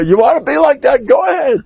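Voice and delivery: high, shouted dare; taunting dare. Acted. Daring the complainer.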